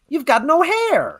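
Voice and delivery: put-on high, slow American kid's voice; American accent. Father retelling his son meeting Stu, in an American accent. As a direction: imitate the son's blunt American accent. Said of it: "'Cause he has an American accent".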